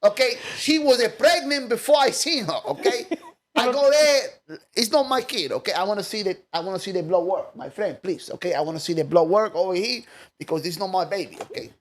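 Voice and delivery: high put-on Dominican Spanish accent; a hammed-up Dominican accent. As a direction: plead like a Dominican guy denying the baby. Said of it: "like, the Spanish".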